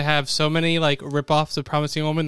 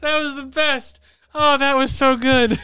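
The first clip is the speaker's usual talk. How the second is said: amused delight; 8 semitones higher, 3 dB louder, slower than usual. Real, not acted. Laughing at Jimmy's hiccup.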